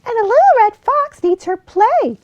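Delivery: high, squeaky little fox pleading; small fox begging the bear to play, high and squeaky. Acted. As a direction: whine to be allowed to play. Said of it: "said Figgy Two Socks".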